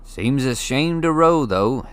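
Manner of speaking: put-on a giant speaking casually to a boy; Hagrid, big friendly giant. A narrator's reading. Hagrid explaining to Harry, casual giant voice.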